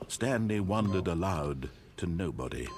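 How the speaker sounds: normal talking voice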